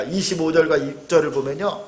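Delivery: usual speaking voice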